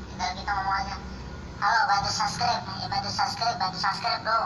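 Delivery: sped-up squeaky chipmunk effect. Shown as a demonstration. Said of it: "gimana caranya membuat suara tupai".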